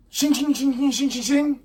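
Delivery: high, ringing "shing" noises; rapid imitated blade swishes; sword sound effect. Storyteller voices Zoro's swords arriving, high metallic imitation.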